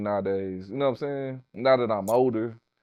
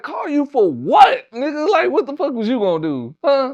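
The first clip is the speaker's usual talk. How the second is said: mock yell; 8 dB louder than usual. Retorting to friend in retold argument.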